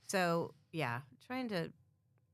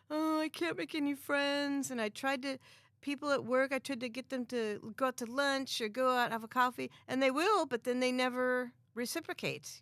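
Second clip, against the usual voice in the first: whiny complaining voice; 7 semitones higher than usual. Retold or imitated. Mocking newcomers' complaint.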